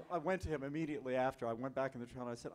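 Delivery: ordinary talk, nothing marked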